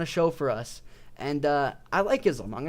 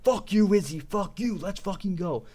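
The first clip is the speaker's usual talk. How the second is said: mock yell, higher but held back; restrained, raised-pitch imitation of hyped yelling; retold hyped yelling; 3 semitones higher than usual. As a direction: re-enact screaming at the fight without real volume. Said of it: "screaming and just yelling".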